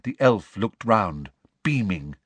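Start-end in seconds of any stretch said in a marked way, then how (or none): none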